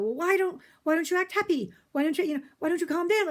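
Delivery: hammed-up husband pestering her to calm down; mock husband voice. Husband's retold complaints.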